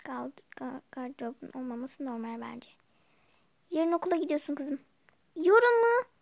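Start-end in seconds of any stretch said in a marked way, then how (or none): 5.4-6.1 higher, louder startled question; surprised. Acted. Daughter shocked to hear school starts tomorrow. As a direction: caught off guard by the news.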